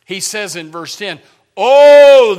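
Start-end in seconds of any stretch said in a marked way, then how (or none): 1.6-2.4 long, high, loud prayerful "oh"; sincere cry to God. Retold or imitated. Preacher voicing Jabez's prayer in verse ten, sincere loud cry. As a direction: cry it out with full force, imploring. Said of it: "very sincere".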